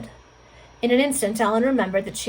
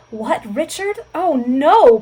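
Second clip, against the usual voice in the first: loud, high cry of disbelief; anguished cry; 5 semitones higher, 10 dB louder, much slower than usual. A narrator's reading. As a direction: build from shocked question to anguished outcry. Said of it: "cried Ellen in a tone of ineffable anguish".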